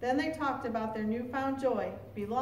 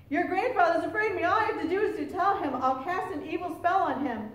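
brisk, higher-pitched assertion; emphatic boast; 5 semitones higher, 4 dB louder than usual. A narrator's reading. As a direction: boast of power over him. Old woman boasts of her power.

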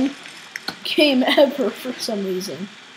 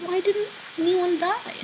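ordinary delivery

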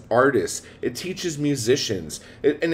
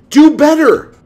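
furious shout; 12 semitones higher, 13 dB louder than usual. Real, not acted. Ranting at complaining male writers.

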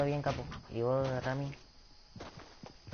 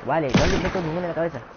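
frustrated fast rant; 5 semitones higher, 6 dB louder, much faster than usual. Real, not acted. Frustrated missing shots.

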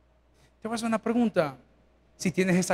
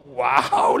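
amazed wow; 9 semitones lower than usual. Retold or imitated. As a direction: exclaim it in wonder. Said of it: "le digo yo al pastor".

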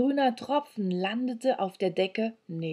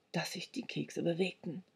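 usual speaking voice

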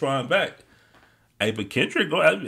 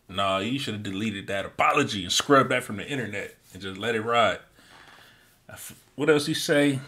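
ordinary talk, nothing marked

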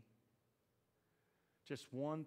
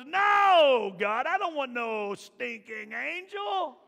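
loud high shout, slow and defiant; Moses shouting at God; 11 semitones higher, 16 dB louder, much slower than usual. Acted. Moses refusing the angel, shouted. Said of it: "Moses looks back at God and goes".

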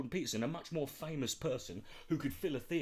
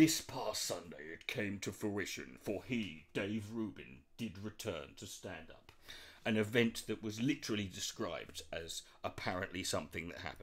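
mock grave announcer; 6 semitones lower than usual. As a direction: intone it like a documentary voiceover.

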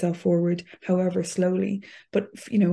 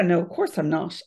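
normal talking voice

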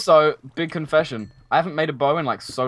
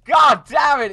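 much higher, louder swearing; frustrated shout; 10 semitones higher, 7 dB louder, slower than usual. Real, not acted. Frustrated at the hidden chest, a loud high shout. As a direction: shout it out in exasperation.

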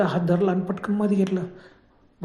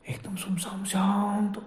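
hushed and slow; 2 semitones higher, 6 dB quieter, slower than usual. A narrator's reading. Hushed eerie night scene.

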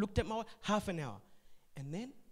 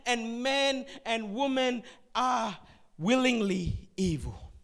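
preaching at full force; 4 semitones higher, 6 dB louder than usual. Acted. Preacher to congregation, building emphatic preaching. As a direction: drive the point home to the congregation.

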